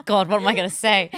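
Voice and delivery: loud, playful mock-panicked thought. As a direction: blurt it out in exaggerated dread. Acts out her panicked thought.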